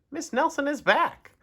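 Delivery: very high, emphatic principal; insistent principal. A narrator's reading. Principal refusing to believe the phone caller, insistent.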